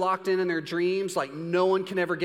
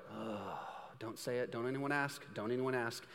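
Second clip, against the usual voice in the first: much softer, low pleading aside; dreading mumble; 5 semitones lower, 11 dB quieter than usual. Retold or imitated. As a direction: beg quietly under your breath. Guests dreading the braggart.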